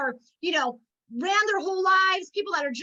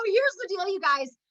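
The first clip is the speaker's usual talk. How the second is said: normal talking voice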